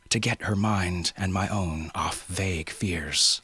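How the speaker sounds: plain reading voice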